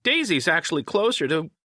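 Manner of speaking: high, teasing creature voice; high-pitched cheeky taunt. A narrator's reading. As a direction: needle them as the high-voiced sidekick. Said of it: "Wish taunted".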